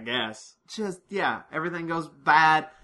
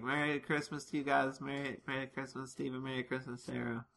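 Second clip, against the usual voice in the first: much softer festive greeting; softly imitating the doctor; 11 dB quieter than usual. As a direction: murmur the Christmas wishes softly. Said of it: "he's like".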